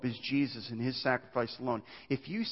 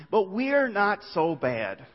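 drawn out, slow and reassuring; slow, soothing reassurance; slow insistence; 6 semitones higher, 4 dB louder, much slower than usual. Acted. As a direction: stretch it out to soothe. Restating the liberal view.

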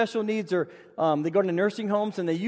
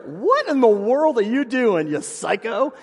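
exasperated outburst; 5 dB louder, slower than usual. Retold or imitated. Mud kicked at them.

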